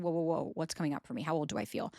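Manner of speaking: soft, low inner voice; retold self-soothing check-in. Woman retelling how she checked in with herself mid-panic, quiet self-talk.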